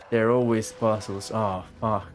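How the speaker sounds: ordinary talk, nothing marked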